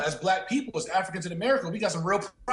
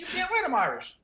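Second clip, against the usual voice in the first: pitched way up, mock-proud; proud retort; 11 semitones higher, faster than usual. Man claiming Irish roots, a hammed-up proud boast. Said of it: "He gonna say".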